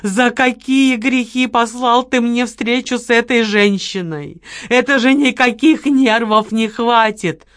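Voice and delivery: loud, slow despairing rant; loud anguished complaint; exasperated shouting. Acted. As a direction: rail at heaven in exasperation. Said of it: "заорал Лёня".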